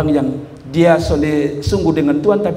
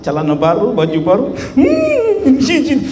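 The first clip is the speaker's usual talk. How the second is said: lightly mimicked scolding parent; imitated nagging parent's itemised list; parent tallying; 4 semitones higher than usual. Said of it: "Dia hitung satu persatu".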